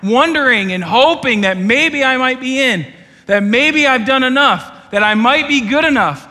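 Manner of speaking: loud, high preaching voice; full-force preaching. Acted. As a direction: preach it hard to the whole room.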